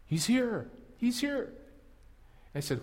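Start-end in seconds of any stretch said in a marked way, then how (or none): 0.1-1.5 bursting with the news, pitch soaring; excited brother. Retold or imitated. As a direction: Andrew rushing in, telling his brother the Messiah has come, thrilled and climbing. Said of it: "Andrew came home so excitedly".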